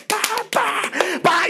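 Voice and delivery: high, popping "pa pa pa" like a fish out of water; squeaky fish-flop imitation; flopping fish noise. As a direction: pop the sounds up high like a flapping fish.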